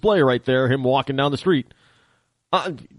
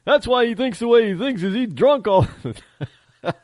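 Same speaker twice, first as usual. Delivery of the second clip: higher-pitched mock dumb listener; mocking a fool listener; 10 semitones higher than usual. Host mocking a listener who thinks he's drunk, higher mock voice. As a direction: sneer in a dopey higher voice. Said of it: "some damn fool out there ... and say".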